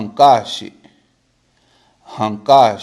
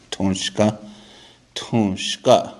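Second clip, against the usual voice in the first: ordinary talk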